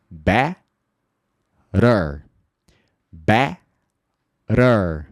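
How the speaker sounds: Better with a flapped t; Better said bera, slow and clear; repeat-after-me Better; drill take. Shown as a demonstration. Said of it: "speak aloud, fala em voz alta".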